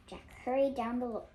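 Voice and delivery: ordinary delivery, nothing marked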